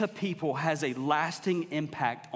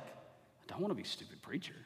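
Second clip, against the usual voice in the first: quiet inner voice; 12 dB quieter than usual. Retold or imitated. Inner voice repeating an insult.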